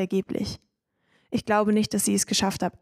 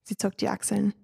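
usual speaking voice

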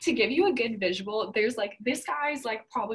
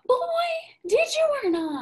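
high, loud, incredulous mock outburst; indignant retold outburst, pitched way up; exasperated demand; 5 dB louder than usual. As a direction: act out losing patience at an evasive answer. Acted exasperated demand to evasive boy.

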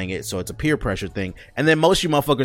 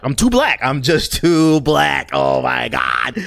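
higher, louder, slow drawn-out; mock high exaggerated; 3 semitones higher, 5 dB louder, much slower than usual. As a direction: ham it up, stretch the words.

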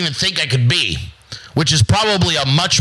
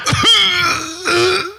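high laugh. Real, not acted. Laugh after bit.

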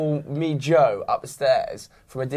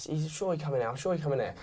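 much softer, lightly imitating her insistence; quiet insisting; 10 dB quieter than usual. Friend quoting the stranger insisting he came in, hushed.

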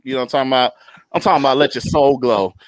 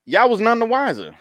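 normal talking voice

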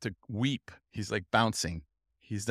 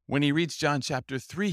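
ordinary delivery

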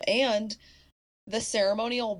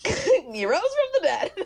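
laughing; 13 semitones higher, 7 dB louder, slower than usual. Real, not acted. Punchline of retold movie ending.

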